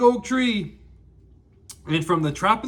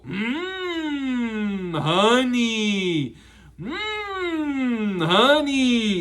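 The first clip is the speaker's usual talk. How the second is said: yummy mmm; much slower than usual. Shown as a demonstration. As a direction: savor the honey. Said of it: "rub their belly and go".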